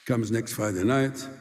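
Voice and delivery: usual speaking voice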